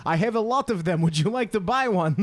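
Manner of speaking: put-on hammy Russian-accented salesman. Russian seller impression.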